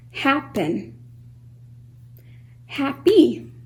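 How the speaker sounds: emphatic word list, each word in two clear beats, from HAPPEN to EAGLE; two pulses per word. Shown as a demonstration. Teacher giving two-syllable examples, HAPPEN to EAGLE in two distinct pulses. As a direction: punch out HAPPEN through EAGLE one at a time, pausing between words. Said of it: "Can you hear the two pulses".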